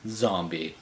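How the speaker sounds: ordinary delivery, nothing marked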